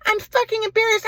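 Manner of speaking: high-pitched hysterical mock-mom tirade; angry mom. Mom berating her embarrassing son, high angry screech. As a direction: mimic a furious mother in a piercing high voice.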